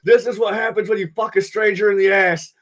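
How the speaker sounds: movie quote rant. Retold or imitated. Quoting the movie back to his friend, hammed-up rant. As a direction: bark the quote like a madman. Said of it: "that Big Lebowski reference".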